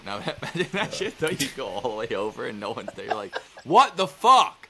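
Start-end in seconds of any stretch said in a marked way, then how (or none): 3.6-4.7 slow, loud outraged yell; drawn-out angry what the fuck; exasperated shout. Retold or imitated. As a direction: belt out the disbelief. Imagined arrival, nobody there.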